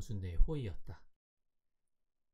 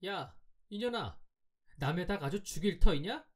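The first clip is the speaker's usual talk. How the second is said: angry scolding; 7 semitones higher than usual. A narrator's reading. Boy scolding the girl over his hen, angry. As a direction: scold in a raised pitch, not a full shout. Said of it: "다시 꽥 호령을 하니까".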